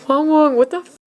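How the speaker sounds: high, jolted yelp of alarm; real startled reaction, cut off. Real, not acted. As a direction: blurt it out high after a jump scare. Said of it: "I legit got scared".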